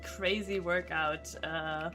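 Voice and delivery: normal talking voice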